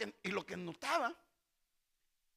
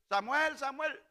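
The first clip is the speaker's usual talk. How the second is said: loud, raised-pitch name call; 6 semitones higher, 8 dB louder, slower than usual. Acted. God calls Samuel another time, loud calling out. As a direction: call out to wake someone.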